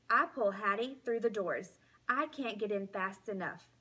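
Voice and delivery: ordinary delivery, nothing marked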